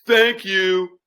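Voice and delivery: cheeky passer-by; leering thanks. Retold or imitated. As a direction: stranger ogling the gardener.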